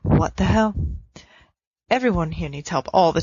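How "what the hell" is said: ordinary delivery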